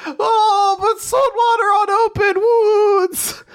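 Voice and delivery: very high, drawn-out mock wail; hammed-up whine. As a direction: whine in falsetto, stretching every word. Repeats the exaggerated protest.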